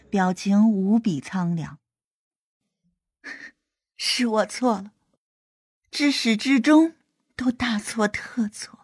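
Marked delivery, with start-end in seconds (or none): 3.2-8.8 bitter laugh. Acted. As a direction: laugh at yourself in despair. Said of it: "他轻笑一声，表情无比苍凉".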